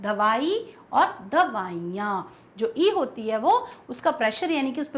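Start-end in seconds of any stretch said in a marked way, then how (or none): 0.0-2.4 दवाई then दवाइयाँ said slowly, weight moving onto या; slow contrast. Shown as a demonstration. Teacher slowly contrasting singular and plural. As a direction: lean on या in दवाइयाँ. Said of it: "यहाँ पर चला जाता है".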